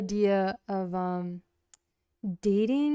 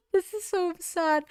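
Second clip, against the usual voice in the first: laughing; 10 semitones higher than usual. Real, not acted.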